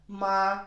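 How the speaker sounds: "Ma" high, loud and level; first tone. Shown as a demonstration. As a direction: sustain "Ma" on one high note. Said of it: "One more time".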